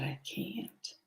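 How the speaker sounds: a choked whisper near tears; whispered through tears, unable to say it; tearful whisper. Retold or imitated. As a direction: barely get it out through the tears. In tears before the mirror.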